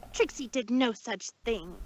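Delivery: usual speaking voice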